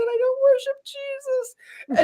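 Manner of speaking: very high, slow mock wail; retold screaming. Woman reliving her imagined trip to hell, wailing pitifully. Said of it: "I was screaming".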